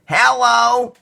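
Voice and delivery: high, loud excited greeting; high and loud child's hello; eager child hello. Acted. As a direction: greet the pilot brightly as a thrilled kid.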